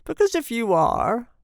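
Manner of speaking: lightly voiced querulous old man. A narrator's reading. Old man complains to his father querulously. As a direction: begin a mild complaint. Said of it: "went on the old man querulously".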